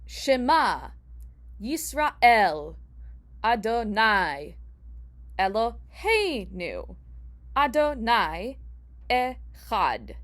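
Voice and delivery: slow, word by word, with a pause after each; choppy recitation. Shown as a demonstration. Teacher giving the Shema as a stress example, choppy and slow. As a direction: recite it choppily, leaning on late syllables. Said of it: "setting aside the very choppy nature".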